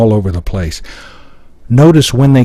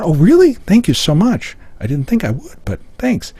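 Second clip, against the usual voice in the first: high, mock-grateful sarcasm; put-on sarcastic gratitude; 7 semitones higher, 4 dB quieter than usual. Shown as a demonstration. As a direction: gush thanks insincerely, then trail off softer. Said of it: "talking in sarcasm".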